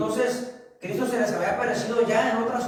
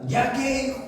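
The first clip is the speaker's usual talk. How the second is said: a wife's sharp ya qué, pitched up; stern wife; 5 semitones higher than usual. Retold or imitated. The wife faces down her husband, sharp mock challenge. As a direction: challenge him like a bossy wife. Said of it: "Y la esposa".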